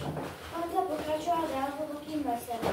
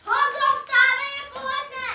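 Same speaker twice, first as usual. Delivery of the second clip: shouting; 12 semitones higher, 13 dB louder than usual. Acted. Father waking son, shouting.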